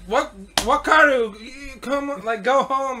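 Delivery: put-on a cop losing patience, shooing a drunk home; frustrated police. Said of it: "the frustration on their, in their voice".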